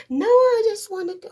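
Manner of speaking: put-on high and loud, gushing kid; dramatic daughter. Daughter brushing off her mother's worry, gushing. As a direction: play the overexcited girl.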